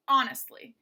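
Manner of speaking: "honestly", loud first syllable then two light ones; stress on HON. Shown as a demonstration. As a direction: hit "hon" hard in "honestly". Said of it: "stressed, two unstressed".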